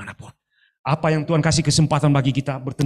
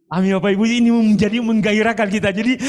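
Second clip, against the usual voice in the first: higher and louder Amen call; rising excitement; 8 semitones higher, 4 dB louder, faster than usual. Real, not acted. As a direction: lift the congregation with a bright call. (seruan amin yang bersemangat)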